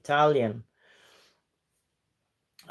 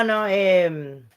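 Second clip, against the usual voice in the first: ordinary talk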